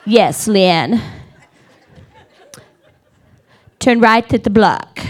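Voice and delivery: lightly imitated seductive Siri; low, half-hearted sexy man's voice; sexy GPS voice. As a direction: do a playful sultry navigator. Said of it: "he talks all sexy to me".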